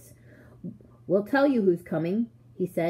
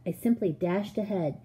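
plain reading voice

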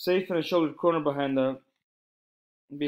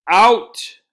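furious bellow, much higher and louder; furious shout; 7 semitones higher, 13 dB louder than usual. A narrator's reading. Headmaster ordering boys out, furious shout. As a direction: blast the word out in rage. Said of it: "shouted Mister Johnson furiously".

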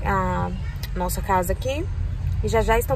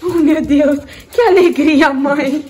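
louder and higher, laughing with joy; joyful laughing; 6 semitones higher, 5 dB louder, much slower than usual. Real, not acted. New mother arriving home, laughing with joy. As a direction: laugh it out, overjoyed.

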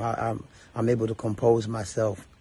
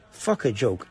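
usual speaking voice

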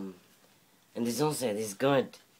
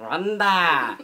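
mock shout; 7 semitones higher, 12 dB louder than usual. Brother copying the silly voice.

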